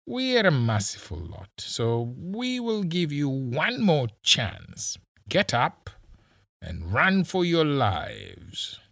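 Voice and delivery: high, slow, drawn-out taunt; mock generosity. A narrator's reading. Policeman offering captives one last chance, slow high taunting. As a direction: stretch out the offer as if granting mercy.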